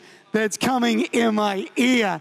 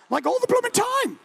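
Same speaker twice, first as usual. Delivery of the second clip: much higher, comic squeal; squeaky emphasis. Acted. Preacher joking about Joan's constant prayers, high squeak.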